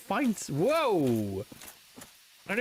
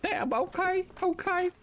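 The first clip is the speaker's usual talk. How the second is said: mock victim; 11 semitones higher than usual. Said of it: "And you're just like".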